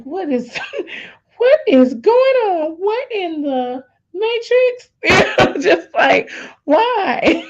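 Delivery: very high, drawn-out disbelief with laughs; comic astonished wail breaking into laughter; hammed-up bewilderment. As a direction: stretch it out, squealing in mock shock. Retold shock at a happy household.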